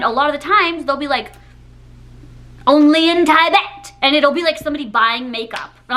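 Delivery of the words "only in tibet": mock caption voice. Mocking social media caption.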